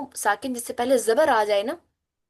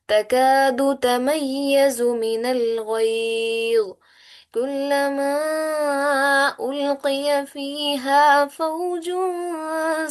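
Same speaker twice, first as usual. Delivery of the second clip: slow melodic tilawat, higher and drawn out; slow recited verse; Quran recitation; 3 semitones higher, much slower than usual. Shown as a demonstration. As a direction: chant each word long and tuneful.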